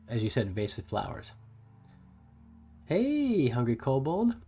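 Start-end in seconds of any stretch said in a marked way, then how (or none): 2.8-4.3 bright, high call-out to a viewer; excited greeting. Real, not acted. Streamer greeting a chat viewer, excited hello. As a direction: sing out the name like spotting a friend.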